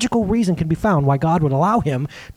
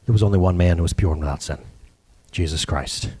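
much lower and reverent; solemn low; 14 semitones lower than usual. Real, not acted. Pastor naming Jesus to the congregation in a low solemn voice. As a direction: drop low and solemn, quickly.

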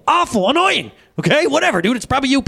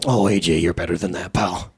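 disappointed Jesus; 12 semitones lower than usual. Pretend.

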